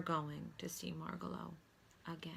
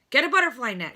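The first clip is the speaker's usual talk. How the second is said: loud barked command; urgent loud call across the room; shouted order; 6 semitones higher, 9 dB louder, faster than usual. A narrator's reading. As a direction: shout for a net. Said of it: "shouted the doctor".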